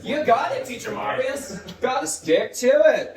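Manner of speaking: loud, high teacher cheer; excited praise. Shown as a demonstration. Teacher praises Teacher Marius for getting it, excited. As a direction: celebrate big and draw out the phrase. Praising pupil, modelling phrase.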